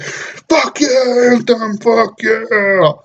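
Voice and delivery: high, slow mock hype; imitating his own past excitement; hyped. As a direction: retell the old hype, drawn out. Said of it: "I was like".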